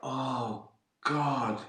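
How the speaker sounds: excited gasp. Real, not acted. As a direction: gasp in delight. Reacting to the question.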